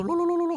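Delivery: high, trilled mumbo-jumbo chant; mock prayer babble. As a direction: rattle off nonsense syllables like a mock blessing. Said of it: "롤롤롤롤 하면서 복 빌어주는 걸".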